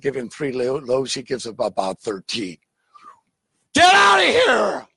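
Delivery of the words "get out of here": high, loud shout; angry shout. Acted. Neighbour shouting the caller away from the door. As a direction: yell him off the doorstep.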